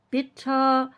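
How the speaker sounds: ordinary delivery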